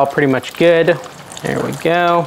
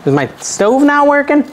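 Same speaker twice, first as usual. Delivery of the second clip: mock exasperated; 11 semitones higher than usual. Oil not sizzling.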